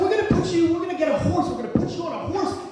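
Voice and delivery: high, loud, urgent yelling; frantic insistence. Retold or imitated. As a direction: insist wildly at the top of your range. Acted argument with his father.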